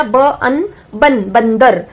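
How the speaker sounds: ब, अन, joined बन, then whole word बंदर; sounding out. Shown as a demonstration. Teacher spelling a word, sounding out ब अन बन, बंदर. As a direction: build बंदर up from ब अन बन, piece by piece. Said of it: "बंदर शब्द अगर हमें लिखना है".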